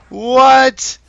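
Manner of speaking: high, loud disbelieving yell; can't believe what you saw; shocked shout. Real, not acted. Caster stunned by result.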